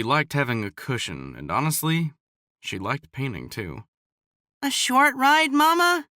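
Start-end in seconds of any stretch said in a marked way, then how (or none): 4.6-6.1 put-on high, pleading little boy; a kid wheedling his mom; begging child. A narrator's reading. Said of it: "Cade begged".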